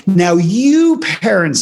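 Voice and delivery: hammed-up sermon to parents; mock lecturing parent-scold. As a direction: lecture the crowd slowly from on high. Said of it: "she didn't go".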